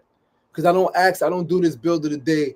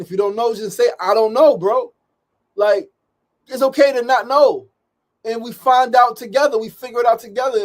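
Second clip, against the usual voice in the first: higher-pitched fired-up rant; passionate rant; 9 semitones higher, 5 dB louder than usual. Real, not acted. Host ranting to viewers about doing nothing, passionate and raised. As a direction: preach it, fired up and insistent.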